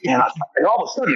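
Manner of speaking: normal talking voice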